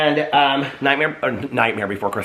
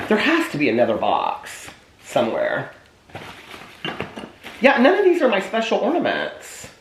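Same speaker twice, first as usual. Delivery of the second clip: normal talking voice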